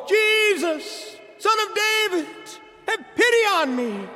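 very high loud desperate cry. Retold or imitated. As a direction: cry out over the crowd. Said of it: "kept calling all the more".

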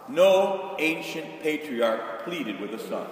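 ordinary talk, nothing marked

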